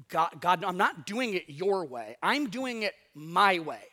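loud, stubborn, defiant declaration; mock a proud rebel shouting at God; a person defying God, loudly; defiant self. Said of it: "We don't want anyone telling us".

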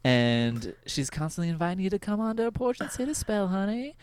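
sweet Southern hostess inviting you onto the porch. Retold or imitated. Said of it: "one character that I play".

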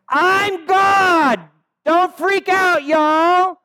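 very high, very loud, slow shout; screaming over wind. Retold or imitated. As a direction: shout it over the howling storm. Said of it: "And so Jesus is saying".

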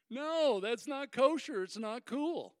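high, emphatic mock refusal; mock objection. Explaining Peter's refusal of shellfish and ham, mock objecting. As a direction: protest it with comic firmness. Acts out refusal of non-kosher food.

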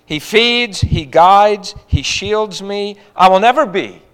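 loud, slow, emphatic sermon voice; preaching at full force. Acted. Preacher voicing David's confession to the congregation, ringing out. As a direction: proclaim each phrase like a testimony.

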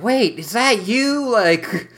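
high, drawn-out confusion; mock bewilderment. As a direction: imitate someone baffled, stretching the words upward. Acted bewildered reaction in retelling.

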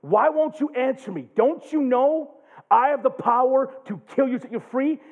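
a hint of a threatening Roman governor, loud; Pilate demanding. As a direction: challenge him like a governor. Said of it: "Pilate tells Jesus".

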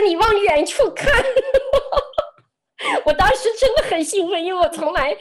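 high, loud, laughing with excitement; excited laugh. Real, not acted. Telling the host how thrilled she was at the window, laughing excitedly. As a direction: burst out laughing with joy.

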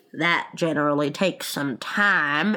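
low-pitched creature interjection; Gryphon interrupting. Acted. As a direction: cut in with a low character voice. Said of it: "interrupted the Gryphon".

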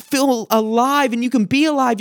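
usual speaking voice

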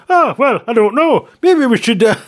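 lightly voiced unsure executive; dithering executive. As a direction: dither like a clueless boss. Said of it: "I'm sounding like the singing from Tidelines".

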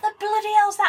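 high, baffled dad asking what it is; dad scoffs in disbelief; dad's outrage. Retold or imitated. Telling her dad about pea puree, mock baffled. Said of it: "he went".

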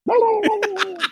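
high, loud gibberish argument; mock arguing in Arabic. Shown as a demonstration. Host asks for the arguing officers again, high gibberish. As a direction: replay the officers' squabble as high nonsense. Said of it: "do them arguing with each other again".

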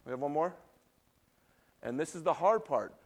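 ordinary talk

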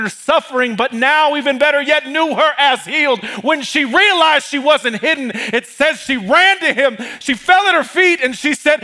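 high, loud preaching, climbing to a shout; rising Pentecostal sermon cadence; full-force preaching. Acted. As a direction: preach it up to the rafters.

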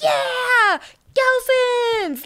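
high, excited group cheer. Retold or imitated. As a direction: cheer it like a big entrance.

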